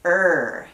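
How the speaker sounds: "Er" as one held, curled-tongue R vowel; isolated teaching "Er"; American R sound. Shown as a demonstration. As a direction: hold "Er" with a strong American R. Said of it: "para pronunciar bien estas palabras".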